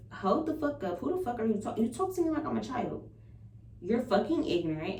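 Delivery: retold angry comeback, starting high then easing down; indignant telling-off. Sister telling off her brother on the phone, mildly indignant. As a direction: put him in his place, mildly heated.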